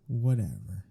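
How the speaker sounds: ordinary talk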